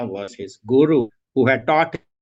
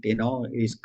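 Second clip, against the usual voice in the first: usual speaking voice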